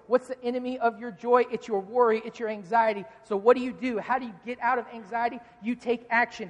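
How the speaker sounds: ordinary talk, nothing marked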